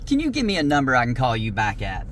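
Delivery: normal talking voice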